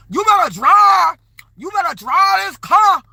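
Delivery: high, loud, belligerent shouting; yelling at the Uber driver in a high, screechy, aggressive voice; loud belligerent woman. Retold or imitated. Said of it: "just loud and belligerent".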